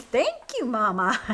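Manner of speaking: Martha's delighted exclamation to Jesus; exclaiming. A narrator's reading. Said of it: "Martha exclaimed".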